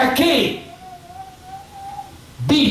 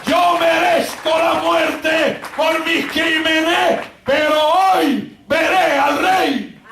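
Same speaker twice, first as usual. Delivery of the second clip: higher, slow, drawn-out cry; declaimed song refrain; 11 semitones higher, much slower than usual. Retold or imitated. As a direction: proclaim the condemned man's song at full preaching force. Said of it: "este joven empezó a cantar".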